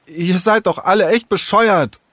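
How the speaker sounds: high-pitched angry insult. A narrator's reading. Sarah storming away from the gang, angry. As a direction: lash out at the whole group. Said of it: "wütend warf Sarah".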